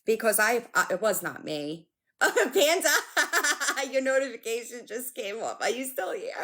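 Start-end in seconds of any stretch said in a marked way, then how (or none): 2.0-3.9 higher, name said through giggles; laughing. Real, not acted. Greeting viewer after a notification, laughing. 3.9-6.3 higher voice bubbling with giggles; laughing. Real, not acted. Asking a viewer if still here, laughing.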